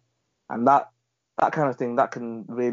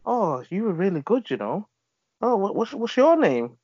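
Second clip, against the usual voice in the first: higher, light imitation of a pleased caller; mimicking a customer; 8 semitones higher, 3 dB louder than usual. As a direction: play the impressed customer, pitched up. Retold praise from a customer.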